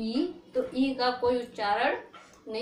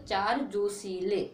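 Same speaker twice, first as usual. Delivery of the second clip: slow mnemonic; 3 semitones lower, slower than usual. Shown as a demonstration. Teacher gives students a memory trick, slowly. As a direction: say चार जोशीले slowly and evenly. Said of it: "इसको याद कर लोगे".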